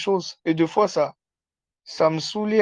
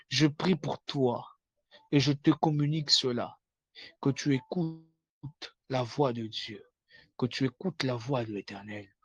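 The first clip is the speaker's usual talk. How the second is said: hushed prayer; 3 semitones lower, 8 dB quieter than usual. Acted. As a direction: pray low and intense. Hushed prayer over listener. (prière à voix basse)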